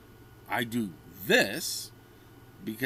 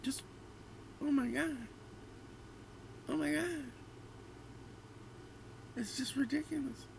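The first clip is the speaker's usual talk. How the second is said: exasperated; 6 dB quieter than usual. Real, not acted. Ranting at a post, exasperated.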